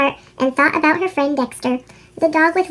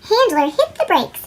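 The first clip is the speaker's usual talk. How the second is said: ordinary delivery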